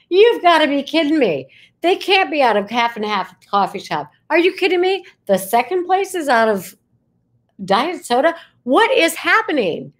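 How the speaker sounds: high, incredulous "you've gotta be kidding me"; exasperated disbelief. Retold or imitated. Reliving the sold-out shops: exasperated, high disbelief. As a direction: throw up your hands in disbelief.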